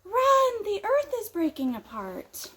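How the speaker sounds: high, loud panicked shout of Run; a frightened rabbit raising the alarm; little rabbit yelling a warning to the others, panicked; panicked rabbit cry. Acted. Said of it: "he tells all of the other rabbits".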